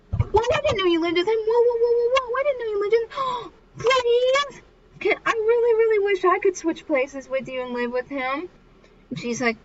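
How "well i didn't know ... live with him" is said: very high, hammed-up squealing fangirls; gushing girls. Girls learning she lives with him, mock starstruck squeal. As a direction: imitate envious schoolgirls gushing over a crush. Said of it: "And they're like".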